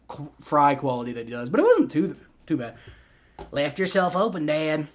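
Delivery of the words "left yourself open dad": Bobby Hill voice. Pretend. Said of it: "I can do the accent".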